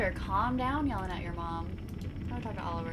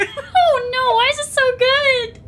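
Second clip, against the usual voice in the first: laughing; 15 dB louder, much slower than usual. Real, not acted. Laughing at own accent.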